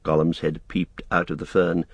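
ordinary delivery

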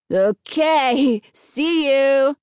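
high, drawn-out farewell call; calling after someone. A narrator's reading. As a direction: call it out to people walking away. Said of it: "Fred called after them".

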